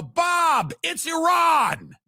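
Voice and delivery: high, loud panicked yell of the news; panicked shout. Acted. Neighbor yelling the news across the yard in panic. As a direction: shout it like the sky is falling.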